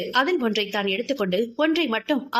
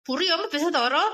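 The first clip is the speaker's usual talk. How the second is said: higher, tight angry warning through clenched teeth; through gritted teeth; 5 semitones higher than usual. Acted. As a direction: bite the words out in pain and anger. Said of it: "கோபத்திலும் வழியிலும் பல்லை கடித்தாள்". (பல்லை கடித்தபடி கோபம்)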